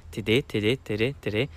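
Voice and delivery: "te de" repeated fast, tongue tapping; fast drill. Shown as a demonstration. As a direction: rattle "te de" quickly over and over. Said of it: "wenn du es ganz schnell aussprichst".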